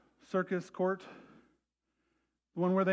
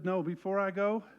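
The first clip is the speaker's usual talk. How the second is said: tender plea. Retold or imitated. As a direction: pray it gently. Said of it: "He said".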